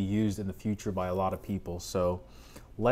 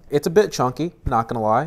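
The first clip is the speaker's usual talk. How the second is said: normal talking voice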